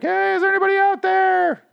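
sarcastic falsetto parody of a character calling out; mocking falsetto. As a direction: mimic her in a silly shrill falsetto. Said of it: "Michonne gets a weird idea to start being like".

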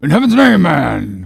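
high, loud outburst; appalled shout at a reckless shipmate; exasperated cry. Acted. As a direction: throw up your hands at the fool. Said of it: "cried Stubb".

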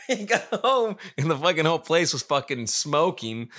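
ordinary delivery

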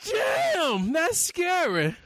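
high, loud, slow shriek; screamed catchphrase. Pretend. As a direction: belt out the show name. Show title shouted catchphrase.

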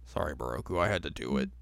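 low, soft grave apology; solemn apology. Retold or imitated. Shippo apologizing to Moroku, low and soft. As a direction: drop it low like a mock solemn farewell.

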